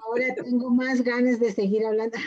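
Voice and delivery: real amused laughter in the voice; laughing. Real, not acted. Host to the coach, keen to keep talking, laughing. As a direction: say it breaking into a laugh. Host reacts as talk ends.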